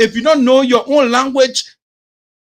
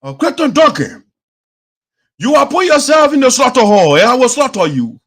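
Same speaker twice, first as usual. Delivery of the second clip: raised, louder mock threat; Teje threatening; 4 semitones higher, 4 dB louder than usual. Host imitating lawmaker threatening his rival, raised mock threat. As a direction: put on a rival's emphatic threat. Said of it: "T I J will go and say".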